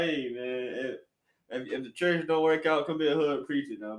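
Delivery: usual speaking voice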